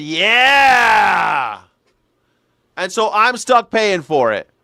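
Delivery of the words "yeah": loud, high, drawn-out mock cheer; cheering baby boomers. Boomers cheering the borrowed-money deal, mock jubilant yell. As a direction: whoop it like a delighted crowd. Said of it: "all the baby boomers said".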